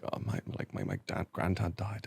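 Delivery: near-whisper, words barely voiced; hushed retelling. Classmate's hushed confession retold, whispered. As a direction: breathe it out, almost silent. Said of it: "And he was like".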